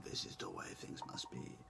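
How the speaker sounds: very low, hushed mock-authority voice; mock rule-giver. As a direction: drop to a near-whisper, imitating someone laying down rules. Mimics rules he hates being told.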